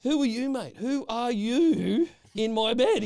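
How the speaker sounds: finding a stranger in his bed, yelling in outrage; indignant yell. Retold or imitated. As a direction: shout it slow at the intruder. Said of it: "I said".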